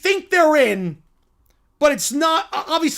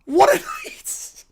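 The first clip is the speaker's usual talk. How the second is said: laughing; slower than usual. Real, not acted. Laughing in joy.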